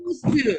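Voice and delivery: usu, the final s released with an extra u; wrong reading usu. Shown as a demonstration. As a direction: say usu as two syllables. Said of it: "bukan usu ya". (usu dibaca salah dengan vokal tambahan)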